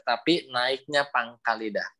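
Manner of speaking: ordinary talk, nothing marked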